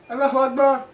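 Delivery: high, loud mock cry through laughter. Podcaster capping a friend's dark joke, mock cry. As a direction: call it out high as a bad-taste joke.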